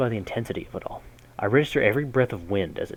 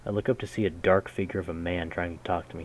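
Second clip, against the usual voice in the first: normal talking voice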